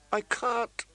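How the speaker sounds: high, tearful cry; weeping. Acted. Catelyn weeping to her son. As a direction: weep the refusal. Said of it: "she wept".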